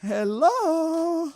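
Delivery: high, drawn-out hello; hammy clerk greeting; bad put-on voice. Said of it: "this is bad".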